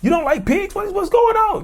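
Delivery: hammed-up shocked innocent; playing the victim. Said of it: "I'm, I'm playing the victim".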